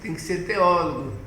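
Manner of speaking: usual speaking voice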